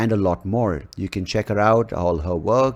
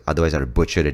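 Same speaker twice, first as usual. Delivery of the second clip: ordinary talk, nothing marked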